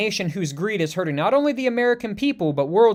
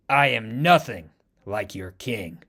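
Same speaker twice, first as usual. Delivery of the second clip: cold menace; 8 semitones lower, slower than usual. Acted. Cold line after the killing.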